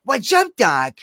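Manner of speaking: lazy wisecracking bunny; quick Bugs Bunny catchphrase. Retold or imitated. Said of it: "He was the voice of".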